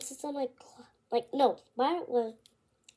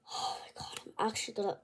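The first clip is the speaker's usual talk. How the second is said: soft whispered mutter; breath-only self-interruption; whispered aside; 4 semitones lower, 12 dB quieter than usual. Real, not acted. Interrupting herself before clearing her throat, whispered.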